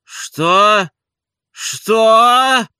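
loud, high, rising challenge; indignant master rearing up at the insult; menacing. A narrator's reading. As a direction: demand it threateningly, mounting on the repeat. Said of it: "грозно заговорил Обломов".

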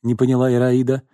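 ordinary delivery, nothing marked

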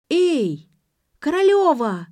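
high shrieking shout across the club; shrieking call. A narrator's reading. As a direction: shriek her name from below. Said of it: "завизжал кто-то".

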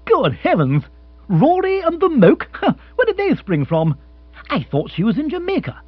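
high, astonished exclaiming; amazed and flustered; startled surprise. Acted. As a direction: react to unexpected visitors. Bill startled by news of visitors.

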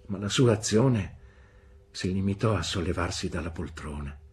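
ordinary delivery, nothing marked

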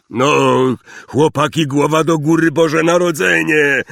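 loud, high, booming cheer-up; rally the boys with festive gusto; hearty encouragement. A narrator's reading. (głośne, serdeczne pocieszanie)

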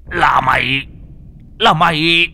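high, loud disbelief; rising shout of shock; shocked recognition. Acted. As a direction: cry out in stunned recognition.

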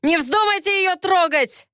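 high, strained angry shout; furious warning. A narrator's reading. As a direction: warn them off in fury. Prisoner threatens his wife's attackers. (яростный окрик)

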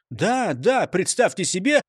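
plain reading voice

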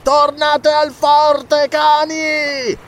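high, long-drawn yell; furious, stretched-out shout; roared order. A narrator's reading. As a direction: howl the command at your men. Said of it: "si tramutò in un urlo che fece tremare la sabbia".

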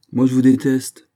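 harsh outburst of temper; hard, angry voice. Acted. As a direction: throw it out hard and angrily. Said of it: "la voix dure et le visage décomposé par la colère".

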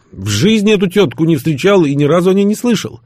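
high, loud nervy denial; somewhat nervous. A narrator's reading. Man denies ever meeting the woman, nervously and loudly. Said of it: "несколько нервно заметил я".

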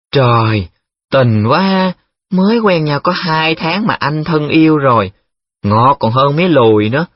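teasing, high-pitched gushing; gushing commentary. A narrator's reading. Nhiệm commenting on the girlfriend's love letter, gushing. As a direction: swoon mockingly over the sweetness. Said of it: "Nhiệm đã hít hà bình luận".

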